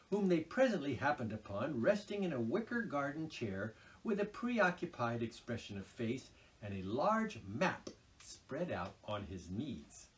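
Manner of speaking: plain reading voice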